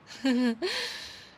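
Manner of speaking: short amused laugh; bright mocking laugh. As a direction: laugh at how little he knows. Laughs at his ignorance.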